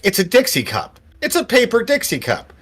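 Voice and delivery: put-on high-pitched kid butting in; kid's high, insistent pipe-up; street kid. Kid correcting the salesman from behind, high-pitched. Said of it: "The kid behind you".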